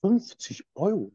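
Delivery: surprised. Retold or imitated. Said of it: "Ich so, Was?".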